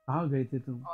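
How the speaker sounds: a low flat demand; teacher demonstrating a flat sound by voicing a nagging question; flat voice example. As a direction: say it flat and low, like a husband asked. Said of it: "और flat sound".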